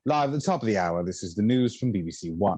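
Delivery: lightly voiced British news read; mock BBC announcer at the top of the hour; a hint of a posh English broadcaster; generic BBC newsreader. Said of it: "only being able to ever do".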